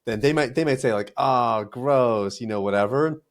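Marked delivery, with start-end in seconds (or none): 1.2-2.4 put-on drawn-out kid-style grossed-out groan; playful retold yuck; mock disgust. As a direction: imitate kids grossed out by their parents. Imitates kids reacting to parents' affection.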